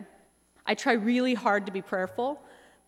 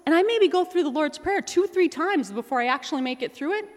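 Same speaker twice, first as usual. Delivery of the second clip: ordinary talk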